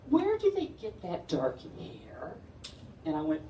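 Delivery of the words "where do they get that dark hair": high, slow prying question; slow, high busybody voice; nosy lady. Retold or imitated. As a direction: draw it out like a nosy neighbour. Bus driver's wife questioning.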